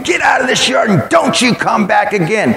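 high yelled command at the dog; chasing shout. Retold or imitated. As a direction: run the dog off with a high yell.

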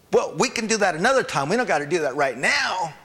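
voice of the flesh. Acted. Preacher's flesh bargaining to delay the fast, mock wheedling. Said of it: "this other voice goes".

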